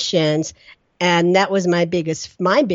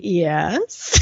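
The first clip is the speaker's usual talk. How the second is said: very cautiously. Retold or imitated. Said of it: "like, very cautiously".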